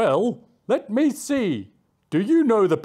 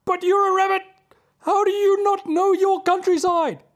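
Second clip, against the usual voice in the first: much higher, louder outraged bird; old bird scolding the rabbit; arguing loudly; 11 semitones higher, 7 dB louder than usual. Acted. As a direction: scold in disbelief. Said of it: "argued the loud bird".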